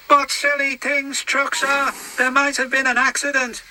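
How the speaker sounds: high, put-on engine voice, sorry for himself; a high little engine voice full of self-pity; James the engine, glum. James alone in the shed, high glum engine voice. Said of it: "said James".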